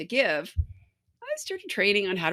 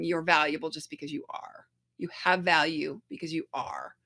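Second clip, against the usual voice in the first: soft, gentle whisper of reassurance; soft inner voice; 4 semitones lower, 5 dB quieter than usual. Retold or imitated. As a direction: whisper it kindly, like a loving guide. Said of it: "lovely voice in my head saying".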